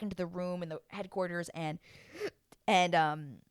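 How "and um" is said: ordinary talk, nothing marked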